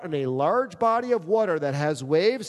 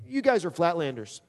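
usual speaking voice

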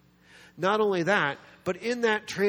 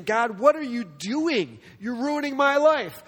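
high, drawn-out acted complaint to God; exasperated protest; 7 semitones higher, 4 dB louder, slower than usual. Student with bad grades protests to God, exasperated. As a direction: wail it slowly in mock despair.

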